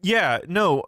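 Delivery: normal talking voice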